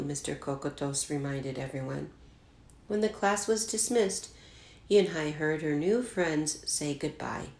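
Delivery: usual speaking voice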